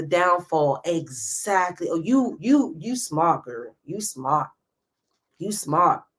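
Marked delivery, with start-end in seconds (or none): none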